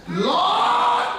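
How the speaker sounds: high desperate cry of Lord; a sudden high shout of Lord; shouted plea. Retold or imitated. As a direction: cry out Lord to heaven in panic. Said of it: "And so I said".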